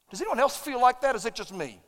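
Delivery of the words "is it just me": ordinary talk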